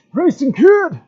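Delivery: put-on high, loud old man cry; old man Odin voice. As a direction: shout the name like an ancient god. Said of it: "You like my old man Odin voice?".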